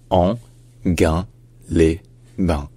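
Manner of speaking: Anguin-les-Bains in four slow, separate syllables; very slow Anguin-les-Bains with pauses between syllables. Shown as a demonstration. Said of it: "il faut la lire comme le mot gain".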